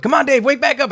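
mock high-pitched pleading friends; high coaxing call, imitating the crowd; friends urging. Friends telling him to wake back up, imitated urging.

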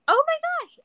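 high, loud mock exclamation; amazed. Driver learning passenger shares his old city, amazed. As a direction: imitate a delighted shock, pitch way up.

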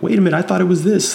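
high, fast mock dawning surprise; surprised realization. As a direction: squeak it out like someone just fooled. Mocking duped listener's realization.

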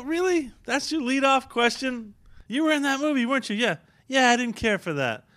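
high-pitched mock disbelief; acted incredulity. Guest mimics a fan at a party, incredulous.

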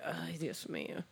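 whispered mock dismay. Imitating the listener's reaction to a long voice note, whispered. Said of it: "se quede escuchando el mensaje".

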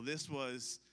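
ordinary talk, nothing marked

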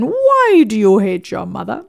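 slow mock German analyst voice; Sigmund Freud. Therapist jokingly facing a dinner guest, mock German accent. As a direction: drag it out like a talking Freud toy, German accent. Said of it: "in the voice of Sigmund Freud in this German accent".

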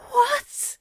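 very high, shocked gasp; choked. A narrator's reading. Aziraphale to accusing lover, choked with shock. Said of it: "he chokes".

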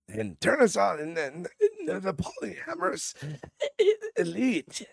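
put-on high, strained old man voice breaking into laughter; frail croaky voice, cracking up; RFK Junior impression. Podcaster mocking RFK Junior, laughing through the strained voice. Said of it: "I'm gonna start sounding like him here".

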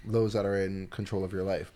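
usual speaking voice

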